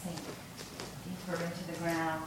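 unhurried yoga nidra voice with long pauses; guided meditation. Acted.

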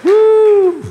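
high, sustained "woo"; excited whoop. Real, not acted. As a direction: let out a high preacher's whoop. Preacher whoops after climax.

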